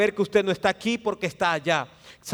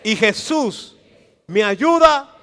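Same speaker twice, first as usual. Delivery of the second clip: rising loud affirmation; 3 semitones higher, 9 dB louder, slower than usual. Shown as a demonstration. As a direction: lift the voice on "me ayuda". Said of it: "Dígalo otra vez".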